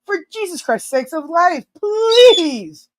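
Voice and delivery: high-pitched, hammed-up begging cry; exasperated pleading. Real, not acted. Streamer pleading with Paulo, mock-desperate cry. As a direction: beg to high heaven, stretching up high.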